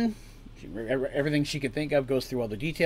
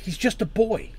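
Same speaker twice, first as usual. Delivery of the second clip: higher, desperate plea; pleading; 7 semitones higher than usual. Acted. Mother begs the man to spare her son, pleading. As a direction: beg him to spare him. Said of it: "Artemis pleaded".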